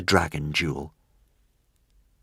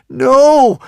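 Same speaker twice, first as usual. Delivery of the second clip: high, very loud cry of protest; yell; 15 dB louder than usual. A narrator's reading. Hiccup sees the dragon collapse, high desperate yell. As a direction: yell it out at full force. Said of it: "yelled Hiccup".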